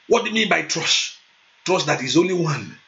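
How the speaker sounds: normal talking voice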